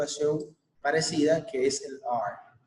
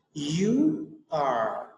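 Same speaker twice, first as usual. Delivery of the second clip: model for repetition. Shown as a demonstration. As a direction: clear model phrase with a pause after it for the class to repeat. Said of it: "Listen and repeat". (modelo claro para repetir)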